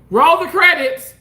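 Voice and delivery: loud, high announcer call; mock showbiz call to roll credits. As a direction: bellow it like the end of a show.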